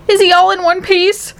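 high-pitched worried mother; acted maternal alarm; anxious cry. A narrator's reading. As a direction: ask it in a high, fretful cry. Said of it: "cried Missus T V".

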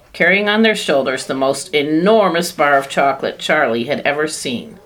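usual speaking voice

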